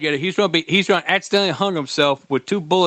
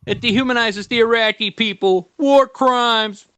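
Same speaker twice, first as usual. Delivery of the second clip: higher-pitched mock whine; mocking complainers; 7 semitones higher than usual. Host imitates critics of a war game, mocking whine. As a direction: pitch it up and ridicule the protest. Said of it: "And people are like".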